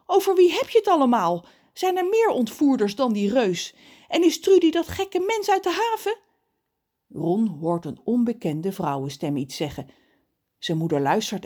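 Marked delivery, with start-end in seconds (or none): 0.1-6.2 high, loud string of questions; agitated mother. A narrator's reading. Mother questions Ron about kidnappers, agitated and high. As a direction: fire off questions in alarm.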